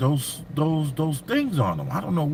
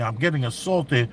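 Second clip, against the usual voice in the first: usual speaking voice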